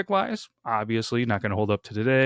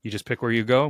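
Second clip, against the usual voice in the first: ordinary talk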